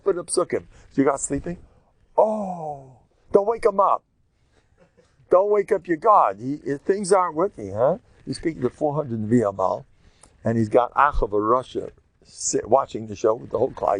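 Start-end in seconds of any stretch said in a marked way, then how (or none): 0.9-8.0 high, loud sarcastic jeer; mocking taunt. Eliyahu taunting the prophets of Baal, loud mockery. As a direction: needle them with fake concern.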